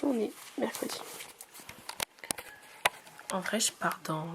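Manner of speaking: ordinary talk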